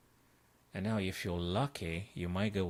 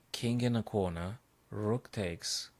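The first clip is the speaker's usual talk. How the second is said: ordinary delivery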